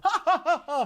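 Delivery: high laugh-like sobbing; hammed-up wailing "ha ha"; crying laugh. Clacker crying in front of Shakespeare, high laughing sobs. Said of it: "he's crying and he's like".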